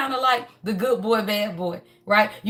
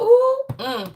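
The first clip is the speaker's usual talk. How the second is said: high, delighted ooh and hum; excited ooh; 13 semitones higher, 4 dB louder than usual. Real, not acted. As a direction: coo it out, thrilled. Reacting to the energy reading.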